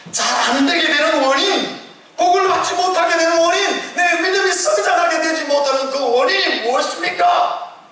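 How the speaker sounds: rising pulpit fervour; preaching at full force. Acted. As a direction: preach it up high and strong. Preacher building up.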